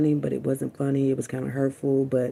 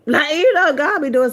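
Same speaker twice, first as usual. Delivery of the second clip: much higher, louder, through a laugh; 11 semitones higher, 8 dB louder than usual. Real, not acted. Laughing to viewers about God's tests. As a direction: burst out laughing.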